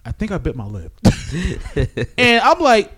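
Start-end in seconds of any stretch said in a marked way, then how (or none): 0.1-1.0 low, quiet imitation of a sheepish friend; imitated sheepish admission. Friend Warren answering his wife, imitated sheepishly. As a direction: drop low and soft, owning up.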